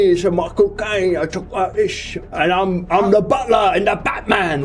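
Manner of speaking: hammy, badly faked British accent; Michael Caine. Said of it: "impersonation, bro".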